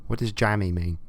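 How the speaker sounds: ordinary delivery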